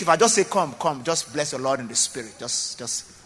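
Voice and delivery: ordinary delivery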